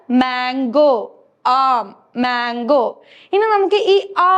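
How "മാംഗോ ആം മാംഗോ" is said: Mango and ആം drawn out slowly, lower; slow teaching model. Shown as a demonstration. Teacher slowly modelling ആം and mango for pupils. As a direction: spell it out slowly for small children. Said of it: "ആം എന്താണ്?".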